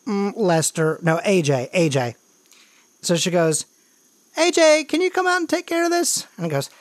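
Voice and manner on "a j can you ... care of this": high-pitched sister calling out; calling little brother. Acted. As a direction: call the kid over in a raised pitch. Seller calls her little brother.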